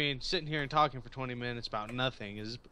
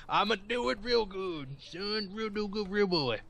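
goofy voice; 8 semitones higher than usual. Pretend. Silly goofy voice.

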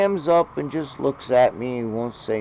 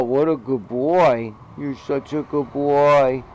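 pet talk; 4 semitones higher, 9 dB louder, slower than usual. Real, not acted. Praising the cat.

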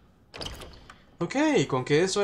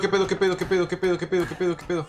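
high, rattled repetition that tapers off; startled, breathless-run repetition; startled panic; 4 semitones higher, faster than usual. Real, not acted. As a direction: blurt it over and over in alarm. Startled by the horror game.

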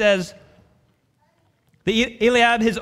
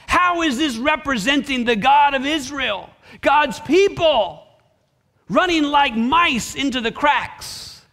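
raised pitch, incredulous outcry; high, forceful pulpit rebuke; indignant preaching; 6 semitones higher than usual. Real, not acted. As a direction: cry out in indignation, stretching the final words. Preacher indignant at fleeing army.

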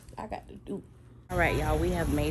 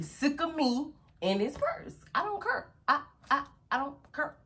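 sassy; 10 semitones higher than usual. Real, not acted. Teasing viewers about the purse.

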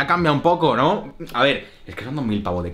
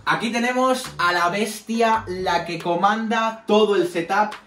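high, slow showman's announcement; announcer voice; 8 semitones higher, slower than usual. Acted. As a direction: present it like a ringside announcer. Presents camera like a showman.

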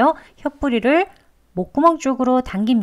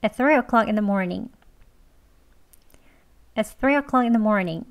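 "It's three o'clock in the morning" linked together at a quicker pace; a bit faster; much slower than usual. Shown as a demonstration. Teacher giving the faster version of the sentence. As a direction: speed it up a little, still clear. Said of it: "조금 빨리 해볼게요".

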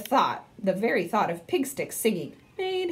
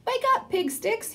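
cried out; 13 semitones higher than usual. Acted. Harold waking Pig Sticks at his window, high urgent cry. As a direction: shout him awake, pitched way up. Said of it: "he cried".

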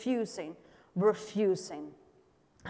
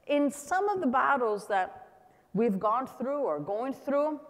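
normal talking voice

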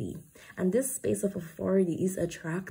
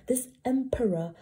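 ordinary delivery

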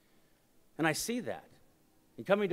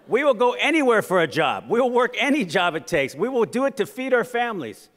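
loud, high, forceful oratory; fired-up crowd-rousing delivery; impassioned rally speech; 6 semitones higher, 9 dB louder than usual. Real, not acted. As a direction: declare it to a whole hall.

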